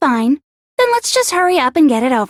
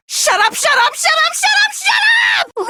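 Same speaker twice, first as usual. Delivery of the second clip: building to a screaming high rage; furious shout; 12 semitones higher than usual. Acted. Junko screaming at the students as she loses. Losing villain shouts them down.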